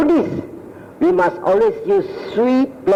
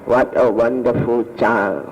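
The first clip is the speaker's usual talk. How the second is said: tender, slow admiring mother; 11 semitones lower than usual. Retold or imitated.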